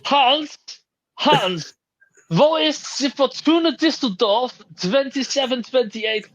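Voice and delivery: high, slow, hammed-up German boss shouting for Hans; mock German official barking orders; panicking German official. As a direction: play a flustered German kit manager. Acted German kit official panicking.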